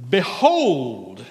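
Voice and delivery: loud, rising mock-solemn "Behold."; a joking pastor recasts a wrench handover as a loud behold; mock grand behold. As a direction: play the wrench handover as a biblical proclamation. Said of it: "laid a wrench underneath a tractor and said".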